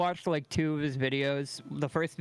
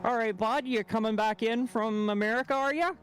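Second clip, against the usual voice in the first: put-on higher-pitched Canadian accent, rising at the end; Canadian border guard; 7 semitones higher than usual. Border guard to a returning shopper, mock Canadian accent. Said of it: "they'd all talk the same".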